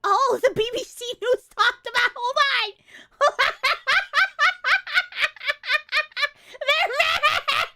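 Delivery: high and loud, laughing through the words; cackling with delight at the news; squealing with glee; gleeful laughing. Real, not acted.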